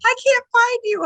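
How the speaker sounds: high-pitched frantic yell, half laughing; acted-out shrill-panic holler. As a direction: yell in a panic at someone out of reach. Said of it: "and I'm yelling".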